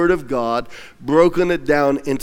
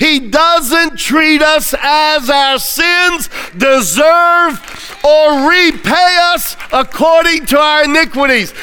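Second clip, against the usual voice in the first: climactic preaching shout; 9 semitones higher, 4 dB louder than usual. Acted. Climax of the sermon.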